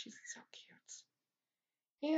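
slow voiceless whisper; soft whisper. Real, not acted. She whispers over her sleeping dog on its couch. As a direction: whisper so as not to wake her.

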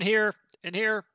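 loud urgent call. Real, not acted. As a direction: call out to your squad. Calling teammate to wounded man.

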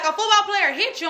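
high, incredulous question; alarmed dad. Retold or imitated. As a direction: imitate a father jumping to alarm. Alarmed father misunderstands crying daughter.

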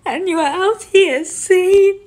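high, rising to a loud outcry; angry accusation. Real, not acted. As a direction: build from bitter to furious. Bitter accusation at relatives.

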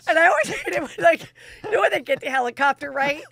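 loud, high, excited retelling through laughter; bursting with it; loud and high, giggling excitedly. Real, not acted.

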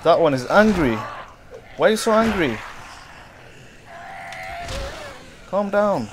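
high, loud, rattled shouting; loud, high-pitched flustered protest; alarmed yelling. Real, not acted. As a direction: yell up high at an attacker coming at you. Fleeing an angry zombie.

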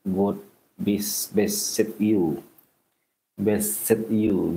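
ordinary talk

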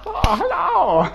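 high, drawn-out mock Chinese accent. Imagining a friend with the accent on, mock Chinese accent. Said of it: "imagine you with a fucking Chinese accent".

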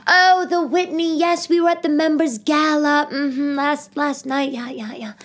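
high posh society lady; rich mother voice. Retold or imitated. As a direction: play a snooty rich New York mom. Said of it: "They'll be like".

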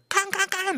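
high clanging "キンカンカン" like a cleaver on metal. Retold or imitated. Narrator imitating cleaver noise from the kitchen, high metallic clang. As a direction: ring it out high like a cleaver striking. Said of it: "みたいな感じで".

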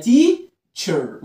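"teacher" very slow, split "teee... cher"; "teacher" with held ee, then cher; long E demo. Shown as a demonstration. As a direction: pause between the syllables of "teacher". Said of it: "اعملها لونج إي".

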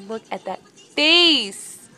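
loud, slow, drawn-out gushing; loud adoring exclamation; gushing excitement. Real, not acted. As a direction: belt it out slowly, stretching the last word. Gushing over the horse's face.

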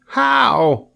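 high piglet squeak; Wilbur. A narrator's reading. Wilbur asks Charlotte how, high piglet voice. Pig asks his rescuer.

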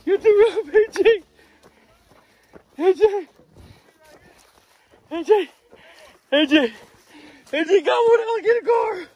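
high, loud excited calls; yelling with excitement after the catch; shouting out. Real, not acted. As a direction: call your buddy over from far off. Calling partner across the property.

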